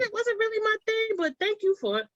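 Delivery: high, soft, apologetic letdown; polite lukewarm thanks. Retold or imitated. Bar customer admitting it wasn't their thing, politely apologetic. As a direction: imitate someone gently declining a recommendation.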